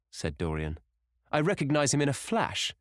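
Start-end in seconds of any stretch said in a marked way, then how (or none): 1.3-2.7 high, delighted exclamation; exclaiming. A narrator's reading. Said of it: "exclaimed the Duchess".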